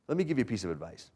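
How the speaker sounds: ordinary delivery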